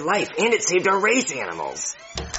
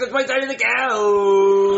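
louder, slow, stretched whining; slow whine; 7 semitones higher, 4 dB louder, slower than usual. Acted. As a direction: drag it out like a sulky child. Drawn-out whining demand.

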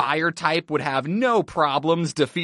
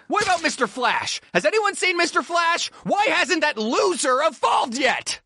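high, agitated demanding rant; exasperated shouting; 10 semitones higher than usual. Acted. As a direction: demand answers in a mounting, high-pitched fit. Acted angry outburst at team.

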